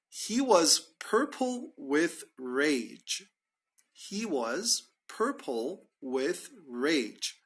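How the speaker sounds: drawn out, each word slow; slow demo phrase. Shown as a demonstration. Teacher modelling the idiom for students, very slow. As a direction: spell it out slowly for learners. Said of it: "you can say".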